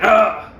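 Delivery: short loud burst of mock roaring; monster roar. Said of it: "the monster comes again".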